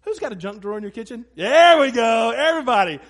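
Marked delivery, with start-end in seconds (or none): 1.3-3.0 very loud shout to the crowd; calling out. Real, not acted. Preacher cheering congregation's raised hands, loud call. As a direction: call out over the whole room.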